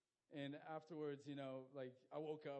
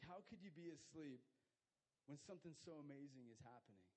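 ordinary talk

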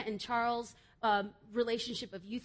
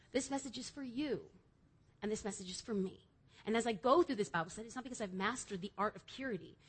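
normal talking voice

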